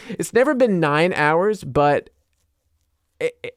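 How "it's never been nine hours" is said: ordinary delivery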